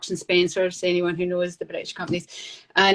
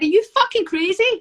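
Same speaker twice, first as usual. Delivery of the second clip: art director's incredulous yell, much higher and louder, laughing through it; retold boss's outburst; 11 semitones higher, 5 dB louder than usual. Art director disbelieving her choice, laughing high yell. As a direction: boss scoffing at her for turning down New York, laughing. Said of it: "And he said".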